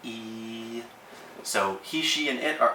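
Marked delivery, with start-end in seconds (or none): 0.0-0.8 E held long on one level pitch; first tone. Shown as a demonstration. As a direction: stretch E out level, without falling. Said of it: "First tone is high and long".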